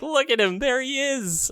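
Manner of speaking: squealing with delight at a favorite villain. Retold or imitated. Spotting Palpatine's return, high gleeful fan squeal. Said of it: "I was like".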